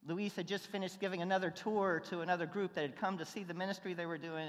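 ordinary delivery

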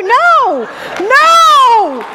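high, loud, slow no, no, don't help me; mock panicked refusal. Introvert shopper loudly begging the clerk not to help.

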